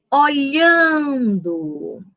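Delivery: Olhando drawn out very slowly, LHAN held long; slow syllable demo, o-LHAAAN-do; stress on middle syllable. Shown as a demonstration. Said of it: "qual é a sílaba mais forte".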